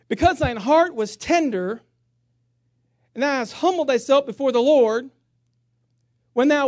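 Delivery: proclaiming King James scripture to the church; scripture reading. Acted. Pastor reads scripture aloud.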